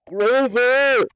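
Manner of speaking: loud, high cry for help; desperate call. A narrator's reading. Hero silently begging Grover for help, desperate shout. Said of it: "I thought desperately".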